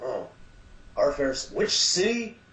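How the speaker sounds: high, playful puzzled voice; rising bemused host voice; impression of Sylvester McCoy. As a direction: tease like a mock-confused celebrity on stage. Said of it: "he kinda went".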